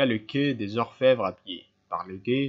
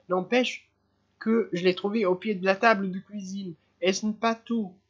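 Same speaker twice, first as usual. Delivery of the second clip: high-pitched, insistent mother; agitated mother; 8 semitones higher than usual. Acted. Mother waving the handkerchief at the inspector, high and insistent. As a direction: keep pressing him, voice high and urgent.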